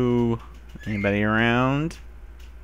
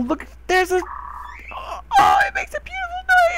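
far higher, squealing and crying out with delight; excited squeal. Real, not acted. Discovering a new creature, an ecstatic high-pitched squeal. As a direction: shriek with joy at the beautiful sound.

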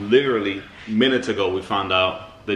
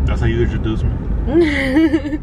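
mock offended; slower than usual. Real, not acted.

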